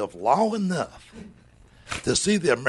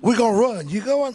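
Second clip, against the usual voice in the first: mock young guy; 9 semitones higher, 6 dB louder than usual. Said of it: "I'm like".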